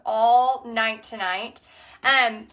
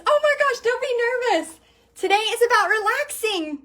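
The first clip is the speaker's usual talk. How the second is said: much higher, bright rush of encouragement; eager reassurance; 9 semitones higher than usual. Real, not acted. Reassuring nervous viewer Bonnie, high and encouraging. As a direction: lift your voice to cheer up a nervous friend.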